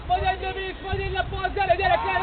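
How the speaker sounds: ordinary delivery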